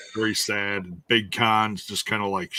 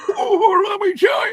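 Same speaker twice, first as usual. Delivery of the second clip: wrestler promo yell; 7 dB louder than usual. Retold or imitated.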